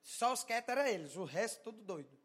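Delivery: normal talking voice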